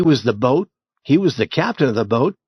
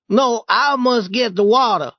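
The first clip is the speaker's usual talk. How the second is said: put-on refusing boy; 11 semitones higher, 4 dB louder than usual. Jim refuses Tom.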